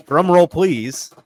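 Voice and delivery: loud, drawn-out showman call; announcer build-up. Real, not acted. Host cueing the giveaway reveal, loud drawn-out announcer call. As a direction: stretch it out to build suspense.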